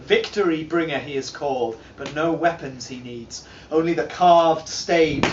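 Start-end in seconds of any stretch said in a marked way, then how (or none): none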